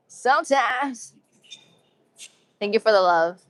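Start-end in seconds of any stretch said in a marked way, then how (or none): 0.1-1.1 higher, very drawn-out silly voice; playful echo. Pretend. Streamer joking about being funny sometimes, playful drawn-out echo. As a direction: stretch it out slowly and comically.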